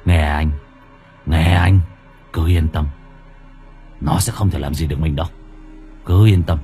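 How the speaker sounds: low, resolute man's voice; firm reassurance. A narrator's reading. Boyfriend promises the ghost cannot harm them, reassuring. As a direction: reassure her firmly in a low voice. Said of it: "My nghe vậy thì cũng cảm giác là yên lòng".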